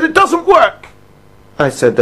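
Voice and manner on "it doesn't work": high, curt complaint; imitating an angry caller. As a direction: bark it like a fed-up customer. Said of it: "He was so angry".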